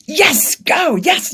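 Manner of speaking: high-pitched hockey fan cheer; celebratory cheer. Acted. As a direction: cheer on the team as it scores. Acting out cheering a hockey goal.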